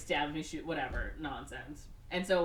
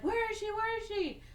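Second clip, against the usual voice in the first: much higher, a hammed-up frantic hero; mock frantic search. Dalton rushing into the hospital, high hammed-up frantic. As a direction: call out for her in a high, mock-anxious voice. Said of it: "And he's like".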